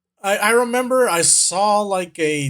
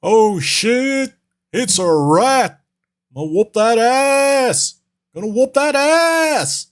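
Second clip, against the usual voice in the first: high, drawn-out mock threat; hammed-up meme quote; 6 semitones higher, 3 dB louder, slower than usual. Quoting an old meme about a rat, in a slow high hammed-up holler. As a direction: stretch each phrase, rising in pitch.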